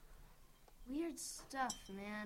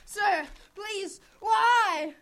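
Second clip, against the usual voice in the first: high plea building to a shout; beg, then cry out the last word; desperate begging; 5 semitones higher, 8 dB louder, much slower than usual. Acted. Boy begs for his life.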